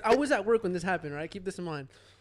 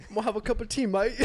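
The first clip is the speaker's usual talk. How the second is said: raised, put-on English accent; 6 semitones higher, 5 dB louder, faster than usual. Jose taking his turn at an English accent, raised and hammed-up. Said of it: "Jose, can you do yours?".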